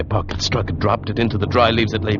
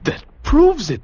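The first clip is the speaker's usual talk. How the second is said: excited outburst; 5 dB louder, slower than usual. Acted. Seizes on the doctor's evidence.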